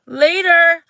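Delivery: high, loud sing-out farewell; breezy brush-off. Acted. Leaving the mean girls behind, loudly breezy. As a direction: toss off a loud, dismissive goodbye.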